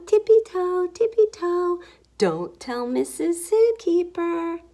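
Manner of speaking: high, bouncy sing-song creeping; playful high-pitched tiptoe chant; sneaky tiptoe. A narrator's reading. Gorilla sneaks after her.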